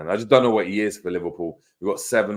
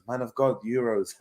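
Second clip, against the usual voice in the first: Curtis Jones; 3 semitones higher, 4 dB quieter than usual. Retold or imitated. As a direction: lightly imitate a footballer begging for help. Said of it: "that's Curtis Jones".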